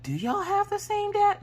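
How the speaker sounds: very high, squeaky doubtful question; mock questioning. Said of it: "even I start to question them".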